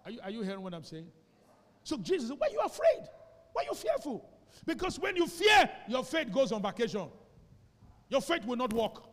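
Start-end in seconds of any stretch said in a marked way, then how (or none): 2.4-9.0 much higher, louder rebuke; preaching shout. Acted. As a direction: shout the challenge at the congregation.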